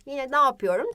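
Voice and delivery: usual speaking voice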